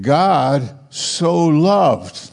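God so loved drawn out very slowly; slow emphatic reading. Shown as a demonstration. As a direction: savour each word, slow and deliberate. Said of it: "But wait a minute".

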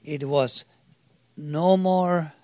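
ordinary delivery

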